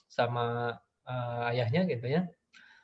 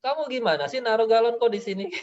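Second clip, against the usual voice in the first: higher, indignant blame; blaming mother; 8 semitones higher, 3 dB louder than usual. Retold or imitated. Mother blaming her child after she tripped over the jug, indignant. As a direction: scold the kid for her own mistake. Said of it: "Menyalahin anak".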